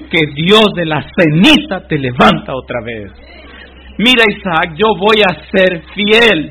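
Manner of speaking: building to a loud, high preaching shout; Abraham crying out to Isaac, loud and rising; impassioned cry. Acted. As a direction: pour out the father's anguish at full force. Abraham cries out before sacrifice.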